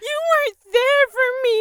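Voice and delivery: very high, loud wounded whine; accusing whine. Retold or imitated. To her boyfriend back with the food, a very high, loud accusing whine. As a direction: whine it like a sulking child.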